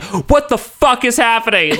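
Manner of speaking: high-pitched, drawn-out panicked dad; mock alarm. Dad sees his possessed son change, mock panic.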